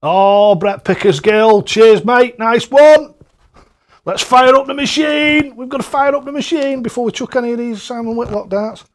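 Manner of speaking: excited hype. Real, not acted. Streamer thanks Brett then hypes firing up the machine, loud. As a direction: rev the crowd like a darts MC.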